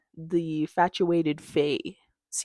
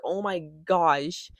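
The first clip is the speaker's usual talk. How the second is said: ordinary talk, nothing marked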